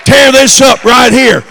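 loud, driving revival preaching; full-force preaching. Acted. Preacher to congregation about tearing up the roof, full-force shouting.

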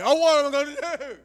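put-on high, loud, whining teenager; mock-dramatic kid despairing over hairspray; whiny kid panic. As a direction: wail it like a helpless kid.

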